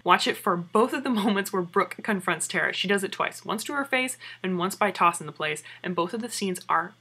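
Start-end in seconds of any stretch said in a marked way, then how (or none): none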